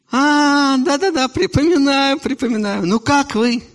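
high eager emigrant; bright high recognition; high character voice. Acted. Returnee character in dialogue.

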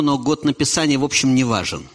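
ordinary talk

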